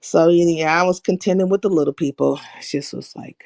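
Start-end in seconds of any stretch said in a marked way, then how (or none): 2.6-3.4 breathy whispered aside. Real, not acted. Leaning in to tell viewers, whispered. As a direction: drop to a whisper.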